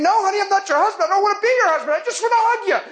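high-pitched, comic insistence; mock exasperated protest. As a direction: protest your innocence in a high, hurried voice. His exasperated inner reply, retold comically.

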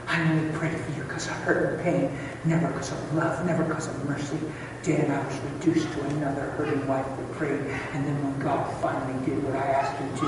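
soft, earnest retold admission; hushed confession. Wife confessing to husband, soft sincere plea.

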